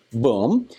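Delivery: ordinary talk, nothing marked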